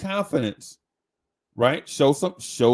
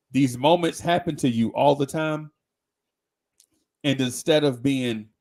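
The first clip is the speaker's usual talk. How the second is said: usual speaking voice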